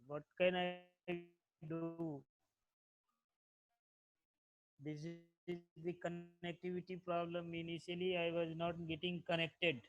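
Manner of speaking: very faint, distant voice; faint bad connection; barely audible. Real, not acted. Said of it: "Sir, your voice is very low".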